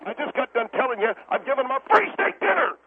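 high-pitched indignant yell; exasperated outburst. Acted. Restaurant owner defending himself to the radio host, exasperated. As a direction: climb into an exasperated shout.

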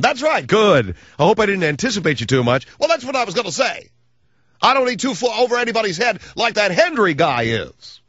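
high cartoonish mock voice; Comb Over Boy voice. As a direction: ask it in the silly high voice. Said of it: "a voice like it's completely out of a cartoon".

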